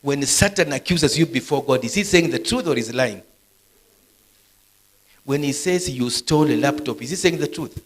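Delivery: loud, high preaching challenge; loud insistent questioning. Real, not acted. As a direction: press the question home at high volume. Preacher re-asks question after cueing audience.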